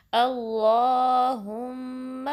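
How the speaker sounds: अल्लाहुम्मा very slow, the लाम full and the alif stretched two counts; slow recitation demo; heavy lam, stretched. Shown as a demonstration. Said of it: "टू count stretch करेंगे".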